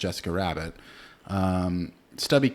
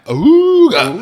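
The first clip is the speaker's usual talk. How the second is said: high, loud, cartoon wolf "ooga"; cartoon ogling howl; 14 semitones higher, 17 dB louder than usual. Ogling Jessica Rabbit, cartoon wolf.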